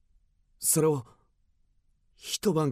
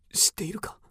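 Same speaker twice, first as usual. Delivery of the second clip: urgent hushed whisper; 8 semitones higher, 5 dB quieter, faster than usual. Acted. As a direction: lean in and whisper it. Secretly asks about Dr. Mera.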